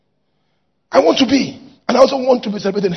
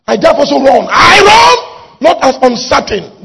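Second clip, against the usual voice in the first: shouted declaration; 3 semitones higher, 7 dB louder than usual. Real, not acted. Preacher declaiming scripture about running the race, building shout. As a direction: proclaim it, rising to a full shout.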